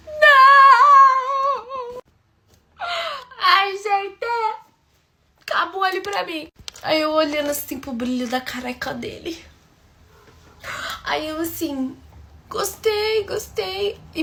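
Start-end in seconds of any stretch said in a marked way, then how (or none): 0.1-6.5 a high, loud, sung-out wail of dismay; horrified squeal. Real, not acted. Horrified reaction to the man. 12.5-14.0 high forced politeness. Retold or imitated.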